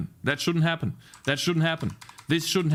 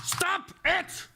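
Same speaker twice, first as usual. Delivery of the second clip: high-pitched frustrated cry; exasperated yell; 4 dB quieter than usual. Real, not acted. Coder shouting at the buggy game, high exasperated yell. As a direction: cry out at a misbehaving program.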